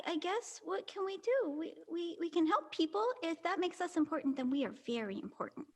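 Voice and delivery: ordinary talk, nothing marked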